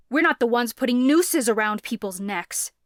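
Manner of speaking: loud angry snap; snapping. A narrator's reading. Son snapping at his father about the nooses, loud anger. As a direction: snap it at him hard. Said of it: "Lucas snapped".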